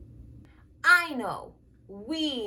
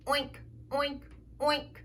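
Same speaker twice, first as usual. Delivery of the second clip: soft oink; 9 dB quieter than usual. Pretend. Introducing the pig.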